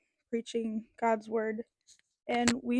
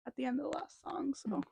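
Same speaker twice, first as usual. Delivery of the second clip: softer whispered confession; whispering; 7 dB quieter than usual. Real, not acted. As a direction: whisper it sheepishly. Whispered admission.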